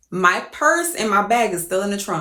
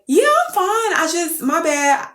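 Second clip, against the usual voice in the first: high-pitched social reassurance; apologetic brush-off; 10 semitones higher than usual. Retold or imitated. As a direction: wave off concern in a high voice. Acts out flustered excuse on arrival.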